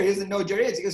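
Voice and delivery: ordinary talk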